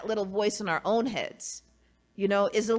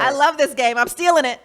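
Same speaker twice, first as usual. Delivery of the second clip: loud, higher, excited outburst with a laugh; high-pitched laughing enthusiasm; real delight; 5 semitones higher, 11 dB louder, faster than usual. Real, not acted. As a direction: blurt it out loudly, delighted by the game. Delight at the card game.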